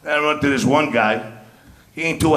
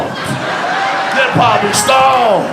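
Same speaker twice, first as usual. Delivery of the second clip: slow, higher, laughing stretch on "stoned"; laughing repeat; 6 semitones higher, much slower than usual. Shown as a demonstration. Comic repeating his punchline to the crowd, slow and laughing. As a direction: draw out "stoned" with a laugh, slowly.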